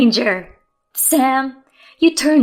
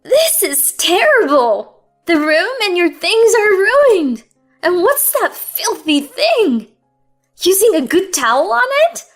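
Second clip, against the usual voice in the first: high, loud, scornful scolding; 9 semitones higher, 10 dB louder than usual. A narrator's reading. Mother-in-law scolding daughter-in-law over the mess, high scornful rant. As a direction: sneer down at her.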